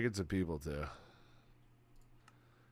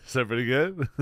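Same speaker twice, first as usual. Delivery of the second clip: normal talking voice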